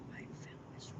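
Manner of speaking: barely voiced, very soft whisper; whispered aside. Real, not acted. Streamer doubting the coming imitation, whispered aside.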